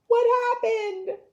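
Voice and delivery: big gasp, high and loud, slow; shocked gasp. Acted. Reading teacher to kids, acting amazed. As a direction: gasp as if the donuts vanished. Teacher gasps at empty plate.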